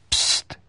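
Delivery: sharp unvoiced shush; hissed shush. A narrator's reading. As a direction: hiss for silence. (sziszegő pszt)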